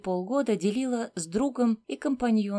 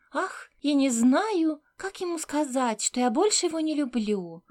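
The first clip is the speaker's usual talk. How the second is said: squeaky mimicking voice; 5 semitones higher than usual. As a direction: mimic a whiny client in a high squeak. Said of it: "Писклявым голосом она кого-то передразнила".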